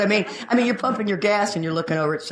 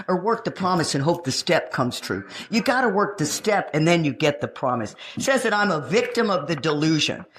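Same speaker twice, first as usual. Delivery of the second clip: ordinary talk, nothing marked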